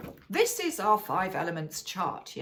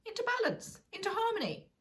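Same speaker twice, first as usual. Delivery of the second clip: 1 soothing; 14 semitones higher, faster than usual. Shown as a demonstration. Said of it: "bring ourselves back".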